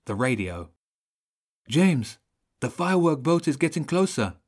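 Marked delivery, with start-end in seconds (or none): 1.7-4.4 high, urgent worried voice. A narrator's reading. Child radios that the boat is getting closer, worried. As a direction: raise the pitch with worry. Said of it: "sounding worried".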